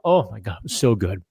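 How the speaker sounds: usual speaking voice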